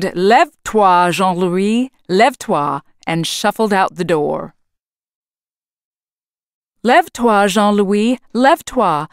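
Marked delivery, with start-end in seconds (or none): 0.0-2.8 slow, booming French wake-up call. A narrator's reading. Lumberjack booming at his sleeping bunkmate, loud. 6.8-9.1 put-on boy repeating. A narrator's reading. Marvin echoing the wake-up call to the giant Jack. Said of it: "Marvin repeated".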